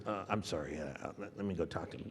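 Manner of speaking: hushed apology. Retold or imitated. Producer voiced apologetic.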